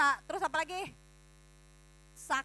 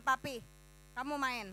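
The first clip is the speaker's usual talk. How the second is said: ordinary delivery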